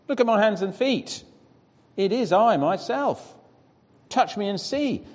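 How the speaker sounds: high, pleading voice of a risen man; Jesus speaking. Acted. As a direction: lift the voice high to show your wounds.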